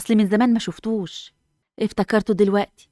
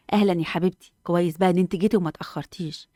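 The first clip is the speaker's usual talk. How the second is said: normal talking voice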